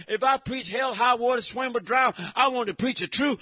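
ordinary talk

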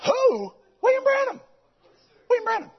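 high, astonished repeat of the name; incredulous exclamation. Retold or imitated. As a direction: say it like he can't believe they don't know. Telling pastor who Branham is.

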